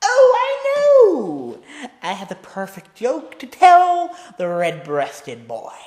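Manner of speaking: high, loud, slow gleeful announcement; Joker voice. Acted. Joker building up his joke for Robin, gleeful. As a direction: savour the big reveal.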